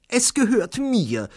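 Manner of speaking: high, loud, drawn-out claim; high-pitched woman passenger insisting; insistent woman. A narrator's reading. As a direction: stake a claim loudly and slowly. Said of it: "behauptete eine Frau".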